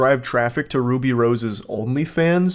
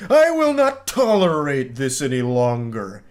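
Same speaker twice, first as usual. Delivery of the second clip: slow, drawn-out villainous outburst; fist-slamming fury. Acted. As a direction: declaim it like a supervillain slamming the desk. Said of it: "slamming his fist into his desk".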